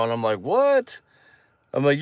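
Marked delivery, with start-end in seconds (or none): 0.4-1.0 very high-pitched "what"; stunned disbelief. Retold or imitated. Boy told he has a girlfriend, stunned. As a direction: squeak it up in mock shock. Retold stunned reply to big news.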